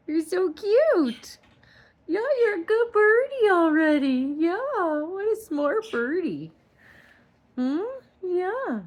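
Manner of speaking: high, loud sing-song pet talk. Real, not acted. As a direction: coo at the bird, high and bright. Baby talk to bird.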